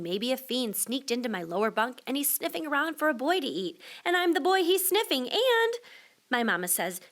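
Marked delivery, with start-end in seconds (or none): none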